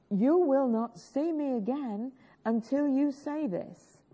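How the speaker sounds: ordinary delivery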